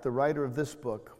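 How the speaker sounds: normal talking voice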